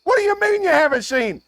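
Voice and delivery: high, incredulous mock scolding; mock outrage. Host teases a guest about an unseen movie, mock outrage. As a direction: give them crap for what they missed. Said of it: "So I can go ... give you some crap".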